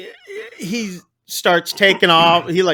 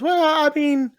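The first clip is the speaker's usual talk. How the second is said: retold flustered stalling; 11 semitones higher than usual. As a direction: mimic her stalling when caught out. Pearl caught in a lie.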